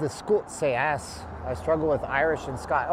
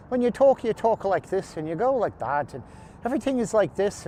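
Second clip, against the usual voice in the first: higher, lilting Irish accent; sing-song Irish; 7 semitones higher than usual. Shown as a demonstration. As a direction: let the Irish lilt sing up and down. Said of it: "Irish accent is like singing a song".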